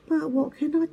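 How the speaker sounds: high, quick, worried owlet; a little owl at a loss; small owl asking. Acted. Twit asks Norma for help, high and quick. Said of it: "asked Twit".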